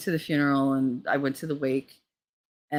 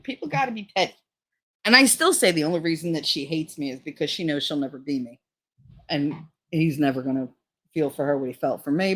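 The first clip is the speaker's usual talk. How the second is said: ordinary talk, nothing marked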